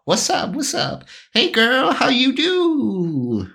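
high, slow smooth-talker come-on; pimpy swagger. Acted. To the half elf, a high slow sleazy come-on. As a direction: strut the line like a sleazy pickup artist. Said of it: "pimpy ass walk".

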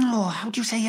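ordinary delivery

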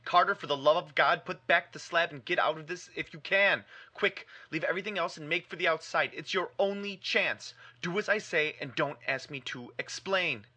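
high, loud, panicked urging; wild consternation. Acted. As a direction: beg him to flee, frantic. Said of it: "in a pitch of wilder consternation".

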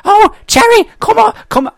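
very high mock nasal-free falsetto mum calling out; imitating a mum calling across the outback. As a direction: squeal it high like a cartoon mum shouting. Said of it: "that's [name] again".

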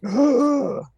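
sharp high gasp of shock; loud high gasp; mock gasp. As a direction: gasp as if scandalized. Shocked at another opened box.

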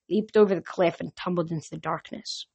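plain reading voice

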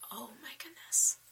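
whispered awe. Retold or imitated. Daughter whispers as she recalls her dad's words. Retold awed reaction, whispered.

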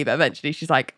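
ordinary talk, nothing marked

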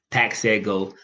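ordinary delivery

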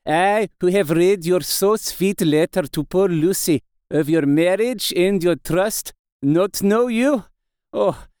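put-on slow foreign-accented professor, full of feeling; accented old doctor, earnest. Doctor tells Mina he knows her through her writing, earnest accented voice.

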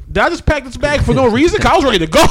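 high, loud, laughing indignation; mock exasperation. Storyteller to friend Warren after false alarm, mock exasperated. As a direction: act out outrage, cracking up as it builds.

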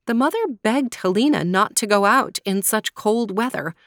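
plain reading voice

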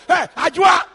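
loud, high shout calling someone over. Acted. Impatient husband calling to the house over food, shouting. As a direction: act out an impatient husband yelling. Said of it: "you're shouting".

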